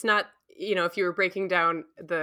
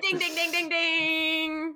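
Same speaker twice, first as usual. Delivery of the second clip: high sing-song ding ding; game show bell; 10 semitones higher, slower than usual. Pretend. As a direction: chime like a quiz show bell. Celebrates own right answer.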